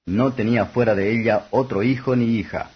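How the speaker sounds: plain reading voice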